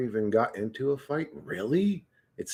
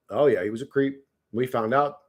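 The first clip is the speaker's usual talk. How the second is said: normal talking voice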